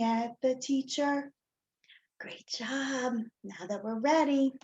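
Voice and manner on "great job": soft unvoiced whisper of praise. Real, not acted. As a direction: whisper it gently, like a secret. Praising children softly mid-routine.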